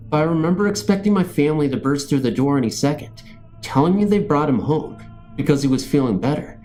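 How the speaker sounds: ordinary delivery, nothing marked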